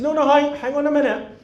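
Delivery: high-pitched urgent objection; insistent interjection. Retold or imitated. Guest stopping the bishop, insistent. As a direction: cut in to halt the listener.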